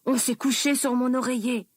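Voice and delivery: ordinary delivery, nothing marked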